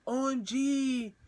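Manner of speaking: high, drawn-out imitation of her shock; mock astonishment. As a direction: stretch each letter out high. Acting out her typed reaction.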